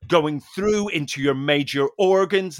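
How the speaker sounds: slow, earnest mock-guru explanation; a hint of the instructor's preachy slowness. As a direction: draw it out like a solemn fitness guru. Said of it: "he was going".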